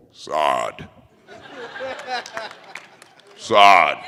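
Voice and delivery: low stern-father call; character catchphrase. Pretend. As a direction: put on a deep commanding voice. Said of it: "so say boy in Malawan".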